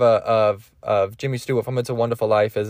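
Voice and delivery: usual speaking voice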